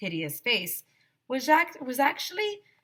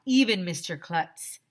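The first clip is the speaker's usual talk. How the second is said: ordinary delivery, nothing marked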